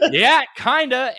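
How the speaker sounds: high-pitched laughing agreement. Real, not acted. Friend agreeing with a laugh about [name]'s posh line, laughing.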